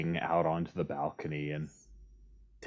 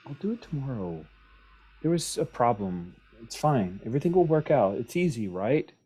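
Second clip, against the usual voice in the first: flustered excuses; 5 semitones higher, 5 dB louder than usual. Acted. Zanaya flustered excuses to Felicia.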